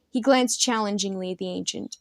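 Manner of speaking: plain reading voice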